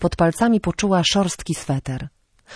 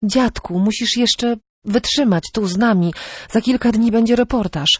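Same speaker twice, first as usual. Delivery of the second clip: higher-pitched, pleading granddaughter; a touch of anxious coaxing; blurted out; 6 semitones higher than usual. A narrator's reading. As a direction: urge him on in a raised pitch. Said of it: "wyrzucała z siebie pozornie zwyczajne słowa".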